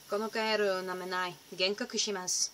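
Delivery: a light English-accented Japanese phrase; casual Japanese. Shown as a demonstration. Vlogger showing her odd Japanese, deadpan. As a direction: say the Japanese warning matter-of-factly. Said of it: "tends to go to things like".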